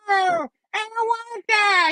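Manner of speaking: high, strained mock wail; desperate villain cry. Goblin clinging on inside Peter's mind, desperate high wail.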